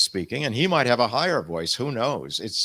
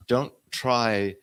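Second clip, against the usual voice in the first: ordinary talk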